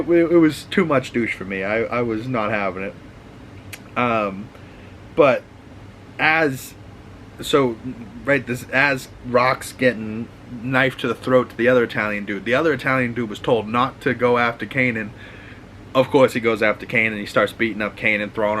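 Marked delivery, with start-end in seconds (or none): none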